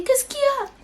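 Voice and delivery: voix nettement plus aiguë, question inquiète; voix aiguë du frère. Retold or imitated. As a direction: imiter le frère qui débarque, inquiet. Imite le frère qui demande. (frère inquiet qui demande, voix aiguë)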